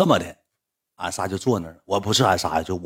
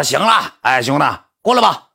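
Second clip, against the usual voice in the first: loud, raised call across the room; 5 semitones higher, 7 dB louder than usual. Retold or imitated. In the cafe, calling his buddy over on the phone, loud raised call. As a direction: shout over to him to come on. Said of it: "我就喊一句".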